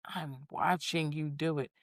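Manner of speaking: low accusing aside. Retold or imitated. Quoting an accuser.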